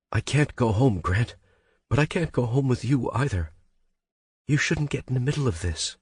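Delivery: high, tearful pleading. Acted. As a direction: plead while close to crying. Heroine pleading through tears.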